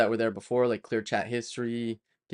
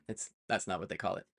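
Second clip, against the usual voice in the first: ordinary talk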